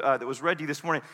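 normal talking voice

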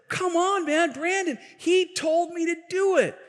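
put-on high pleading kid voice; a kid squealing that his friend told him to; protesting kid. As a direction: push the blame onto Brandon, high and whiny.

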